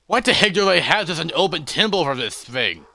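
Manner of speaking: high, loud, rapid disbelief; exasperated outburst. Acted. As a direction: burst out in alarmed protest. Character alarmed at unguarded dangerous gem.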